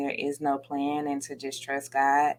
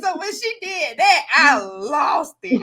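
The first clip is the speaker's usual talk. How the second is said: louder, slow, crying out; 9 semitones higher, 6 dB louder than usual. Real, not acted. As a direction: let it crack into tears. Recalling crying at wedding.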